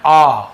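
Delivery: teacher's loud aw on "आ"; vowel sound demo. Shown as a demonstration. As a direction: model "आ" as a rounded aw for learners. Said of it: "अलग ध्वनि निकलता है".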